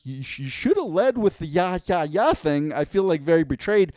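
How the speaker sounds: mock-wounded complaint; betrayed sister. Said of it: "And he's like".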